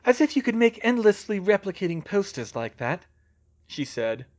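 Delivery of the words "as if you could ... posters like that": lightly voiced Hermione, pitched up; higher, dismissive musing; Hermione scoffing. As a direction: scoff at the absurd idea.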